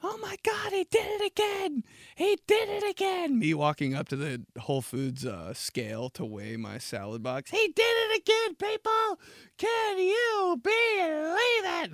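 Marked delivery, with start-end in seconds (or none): 0.0-3.4 very high shouted announcer hype; wrestling commentator. Pretend. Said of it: "There's an M C there". 7.5-11.9 very high shouted announcer hype; wrestling commentator. Pretend. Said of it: "There's an M C there".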